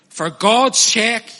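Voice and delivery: For God's sake dragged out slow and loud; a preacher begging the congregation, loud and slow; slow loud plea. Real, not acted. Loud, slow urgent appeal.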